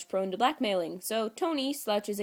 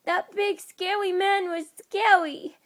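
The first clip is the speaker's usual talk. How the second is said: put-on much higher, slow tearful little girl; blubbing child; 12 semitones higher, 7 dB louder, slower than usual. A narrator's reading. Tasha scared of Fury, much higher, tearful toddler voice. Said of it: "Tasha blubs".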